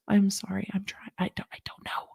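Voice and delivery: hushed, sheepish whisper; barely voiced, embarrassed; whispered apology. Real, not acted. Vlogger whispering sorry to Pam after a failed accent. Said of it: "embarrassed aside after failed accent".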